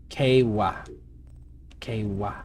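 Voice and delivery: "Kewa" repeated as KAY-wah, each falling; practising a pronunciation. Shown as a demonstration. Streamer repeats a name to remember it, measured "Kewa". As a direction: drill "Kewa" in two separate syllables, KAY-wah. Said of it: "I gotta say that a few times".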